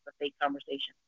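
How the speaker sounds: soft, private remark; low voice. Retold or imitated. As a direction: say it under your breath. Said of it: "In a normal, low voice".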